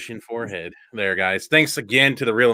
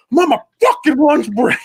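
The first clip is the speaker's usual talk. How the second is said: loud, much higher movie-quote outburst; mock irate yell; 14 semitones higher, 7 dB louder than usual. Santa to a mother on his break, mock irate yell. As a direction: imitate Santa blowing up, dragging out the curse.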